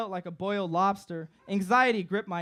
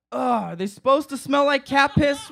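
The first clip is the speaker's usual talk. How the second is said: disgusted; 6 semitones higher, 8 dB louder than usual. Retold or imitated. Roommate disgusted by brownies.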